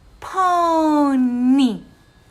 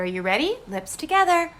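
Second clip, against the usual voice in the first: ordinary delivery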